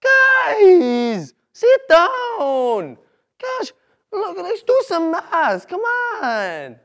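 high, loud yelling at the class; teacher impression. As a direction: shout them back into their seats.

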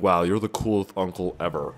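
ordinary talk, nothing marked

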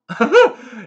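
sudden high loud laugh; a real high burst of laughter. Real, not acted. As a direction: crack up hard at the joke. Laughing at Wade's time warp.

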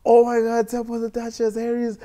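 high-pitched, loud excited squealing; going crazy. Retold or imitated. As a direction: imitate a sister freaking out. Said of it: "And she goes crazy".

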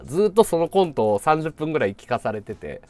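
ordinary talk, nothing marked

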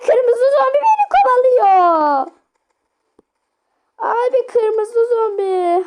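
high panicked cry while fleeing; being chased. Real, not acted. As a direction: yelp it out, chased and scared. Red zombie chasing him.

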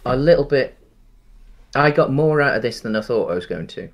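ordinary delivery

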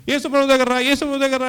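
preacher's call. Retold or imitated. Speaker imitating preachers inviting people to Jesus, loud and high. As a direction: mimic a preacher's altar call.

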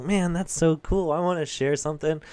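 put-on high, eager kid voice; excited kid. Son reacting in class, high eager kid voice. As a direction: chirp it like an eager little boy. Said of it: "my son was like".

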